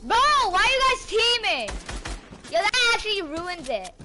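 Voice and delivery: loud, high, furious yelling; outraged shout. Real, not acted. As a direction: scream it in outrage. Outraged at enemies teaming.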